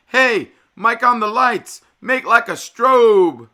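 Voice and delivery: loud, high, slow call to the lighting man. Retold or imitated. DJ calling to Mike on the lights over the music. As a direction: shout it across the party. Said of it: "he would call".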